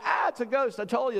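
high, hammed-up ghost scare; mock fright. Disciples see Jesus appear behind locked doors, hammed-up fright. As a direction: squeal it like startled disciples.

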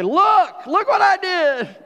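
bursting with pride over a doorknob; giddy show-off. Retold or imitated. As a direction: squeal like a kid showing off his work. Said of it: "I was so excited".

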